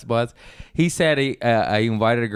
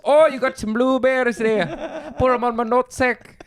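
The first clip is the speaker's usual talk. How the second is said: very high, silly Irish squawk; Irish accent; 13 semitones higher than usual. Acted. Joining in teasing the Irish buddy, cartoonish Irish accent. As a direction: push a mock-Irish accent into absurdity. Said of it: "don't do his accent".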